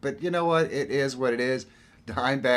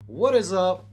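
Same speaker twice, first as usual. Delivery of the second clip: excited greeting; 10 semitones higher, 8 dB louder than usual. Real, not acted. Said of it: "streamer greeting".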